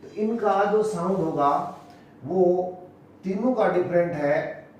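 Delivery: ordinary talk, nothing marked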